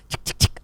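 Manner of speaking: quick soft tongue clicks; rapid ticking sound effect; web-tying ticks. Acted. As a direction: tick away softly as the web winds. Imitating web being tied.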